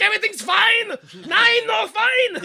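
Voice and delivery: very high, loud mock German yell; panicked cover-up. Lancer reacts to spilled coffee, mock panicked German shout. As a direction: shriek reassurance, fooling nobody. Bad sleeper cell overreacts.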